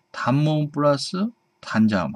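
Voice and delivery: normal talking voice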